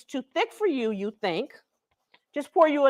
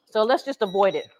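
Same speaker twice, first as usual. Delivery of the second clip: ordinary talk, nothing marked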